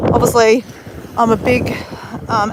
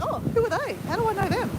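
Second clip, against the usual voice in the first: high, soft wondering to oneself; mock puzzlement; 10 semitones higher, 10 dB quieter, faster than usual. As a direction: puzzle over who these strangers are, high and hushed. Her puzzled thought at waving strangers.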